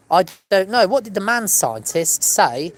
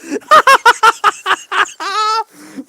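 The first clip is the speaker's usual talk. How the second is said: big burst of high laughter; loud high laugh; 9 dB louder than usual. Real, not acted. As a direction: crack up loudly. Laughing at own joke.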